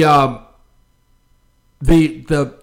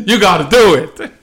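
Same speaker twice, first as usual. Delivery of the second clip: insistent raised voice after a laugh; yelling at the TV; 2 semitones higher, 5 dB louder than usual. Retold or imitated. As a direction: urge the TV on, half laughing. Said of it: "screaming at the T V".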